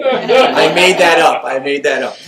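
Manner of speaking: loud, slow and laughing; laughing confession. Retold or imitated. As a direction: own up through laughter. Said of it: "she's going".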